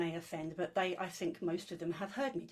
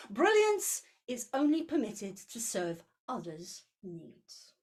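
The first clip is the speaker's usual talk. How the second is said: measured, expressive poetry reading with rising peaks; poem reading; 4 semitones higher, 4 dB louder than usual. Acted. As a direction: read it like a grand cosmic letter. Reads the rejection letter poem.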